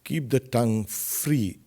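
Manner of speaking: ordinary talk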